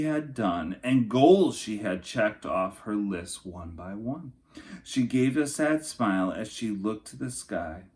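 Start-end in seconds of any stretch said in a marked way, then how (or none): none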